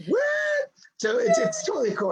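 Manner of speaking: high, shrieking geek-out; geeky excitement. Retold or imitated. Host imitates her own fangirl squeal about the stories. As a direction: squeal like a starstruck fan.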